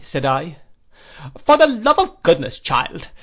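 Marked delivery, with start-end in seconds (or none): 1.4-3.1 put-on much higher, louder indignant old lady; exclaiming aunt. A narrator's reading. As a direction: cry out in exasperation at a child. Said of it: "exclaimed my aunt".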